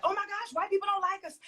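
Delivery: high, gushing fake alarm, mockingly; mock gullible gasp. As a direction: squeal it like a naive viewer being mocked. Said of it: "they're gonna be like".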